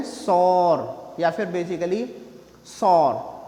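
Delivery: सॉर said flat as one syllable; सॉर with a hard r; wrong sour. Shown as a demonstration. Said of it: "ना तो सॉर है". (sour का गलत उच्चारण)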